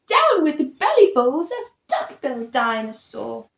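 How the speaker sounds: usual speaking voice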